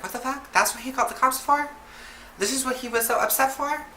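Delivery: very high-pitched questioning outrage; incredulous. Retold or imitated. Family to cops about a parked car, incredulous. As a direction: retell indignant disbelief, voice jumping way up.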